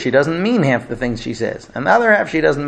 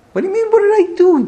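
outraged wife; 6 dB louder, faster than usual. Retold or imitated. Wife's outraged retort in quarrel.

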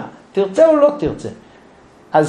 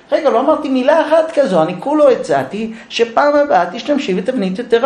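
laughing protest; 7 semitones higher than usual. Acted.

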